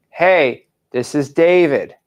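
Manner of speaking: slow, separated words; dictating. Shown as a demonstration. Coach giving trainee the first sentence, slow dictation. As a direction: dictate it so they can copy. We're gonna write it.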